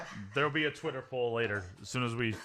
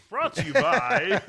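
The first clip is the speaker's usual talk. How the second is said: higher, louder, slow announcer intro; mock sponsor read; 9 semitones higher, 7 dB louder, slower than usual. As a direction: announce it like a cheesy ad.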